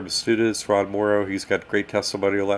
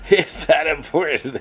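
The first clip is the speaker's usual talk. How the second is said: laughing, higher mock repeat; 6 semitones higher, 4 dB louder than usual. Real, not acted. Host laughs while echoing a viewer's comment. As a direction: laugh it off as you echo the question.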